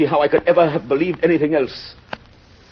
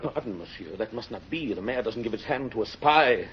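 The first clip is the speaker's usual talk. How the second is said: much softer, low and stiff; quiet refusal; 3 semitones lower, 11 dB quieter than usual. Acted. Javert refusing the mayor's hand, quiet and low.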